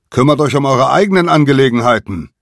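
loud, fast yell; defiant rapid shout; angry shout. A narrator's reading. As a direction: hurl it at the attackers. Simon yells at the falcons.

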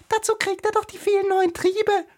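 very high, loud squeaky flower; piping little daisy voice; daisy calling out. A narrator's reading. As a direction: shriek it like a tiny flower. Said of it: "rief ein Gänseblümchen".

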